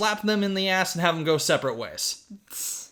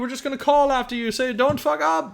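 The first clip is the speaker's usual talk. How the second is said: much higher, louder nagging grown-ups; fussy adults; 12 semitones higher, 4 dB louder than usual. Retold or imitated. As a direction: scold half-heartedly from afar. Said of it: "all of the adults are like".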